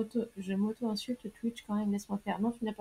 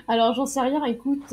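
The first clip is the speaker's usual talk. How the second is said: normal talking voice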